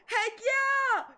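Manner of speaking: high, loud celebratory whoop; cheering. Real, not acted. Cheering Phil for his speedrun, high loud shout. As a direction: cheer him on like he won.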